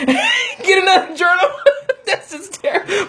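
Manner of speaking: laughing high and bright. Real, not acted. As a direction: laugh through the words. Laughing at own habit.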